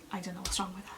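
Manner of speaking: soft, almost whispered remark; hushed aside. Retold or imitated. Midwife remarking on the screaming patient, hushed aside. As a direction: drop to a quiet, baffled undertone.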